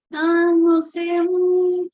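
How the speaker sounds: high, loud, drawn-out whiny dodge; stalling. Retold or imitated. Mother imitating her son refusing to answer, whiny stalling. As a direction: mimic a child dragging out a reluctant non-answer. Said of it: "así".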